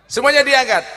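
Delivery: high, loud crowd command; calling out. Real, not acted. Preacher telling everyone to raise phones, loud call. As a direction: send it out over everyone.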